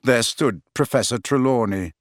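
plain reading voice

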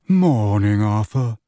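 a mournful voice from the tomb; sepulchral. A narrator's reading. Mournful Bode greeting Arthur in the lift, sepulchral. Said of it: "in a sepulchral voice".

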